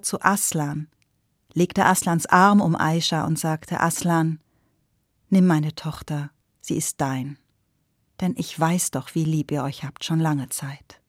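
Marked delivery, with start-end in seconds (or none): 3.8-11.0 1 tender blessing. A narrator's reading. Father gives daughter in marriage.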